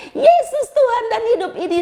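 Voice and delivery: high, loud proclamation; joyful shouting. Retold or imitated. Woman held by God proclaiming her faith, shouting. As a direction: cry it out with no fear at all. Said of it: "langsung saya berteriak".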